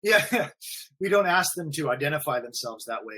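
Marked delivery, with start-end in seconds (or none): none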